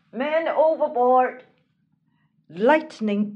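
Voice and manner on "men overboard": raised, urgent call of alarm; alarm cry. Acted. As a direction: shout a warning across the deck. Alarm call in storm at sea.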